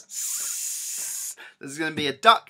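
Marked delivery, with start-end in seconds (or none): 0.0-1.4 soft hissing snake. Shown as a demonstration. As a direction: hiss like a snake. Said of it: "Make a snake noise".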